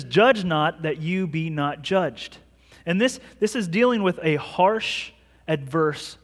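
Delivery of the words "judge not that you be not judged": said very slowly, each word weighted; slow scripture quote. Shown as a demonstration. Pastor reading Jesus' words to the church, slow and deliberate. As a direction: read the verse slowly and deliberately. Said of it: "He says".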